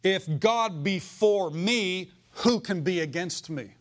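declaring faith over the bill, slow and loud; bold declaration. Shown as a demonstration. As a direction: proclaim it boldly and slowly. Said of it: "You know what you can do?".

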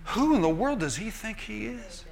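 David scoffing at the giant; incredulous David. Retold or imitated. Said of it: "he walks over and he says".

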